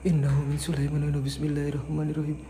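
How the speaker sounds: low, hushed murmur of a prayer in the dark. Real, not acted. Ghost hunter murmurs a prayer at night, hushed. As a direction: keep it barely audible, as if not to wake anything.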